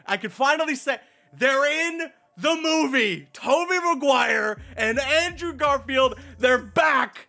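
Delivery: loud, high, thrilled yelling; excited shout. Real, not acted. Reviewer telling viewers about the cameo, thrilled shouting. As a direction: shout the news like you can't contain it.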